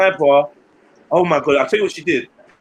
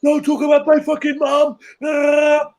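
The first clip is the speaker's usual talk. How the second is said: retold shout he was supposed to give back; mock shout; 8 semitones higher, slower than usual. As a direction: bark it like he should have. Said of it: "supposed to be like".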